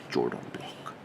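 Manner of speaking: soft whispered echo of the accented Jordan block; whispered repeat. Shown as a demonstration. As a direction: whisper it to yourself. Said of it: "That's how he talked".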